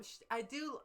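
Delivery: ordinary talk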